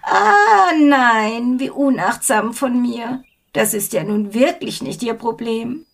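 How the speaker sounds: a high, loud fake gasp of regret; mock apology. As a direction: pretend to be sorry, overdoing it. Feigned regret after cackling.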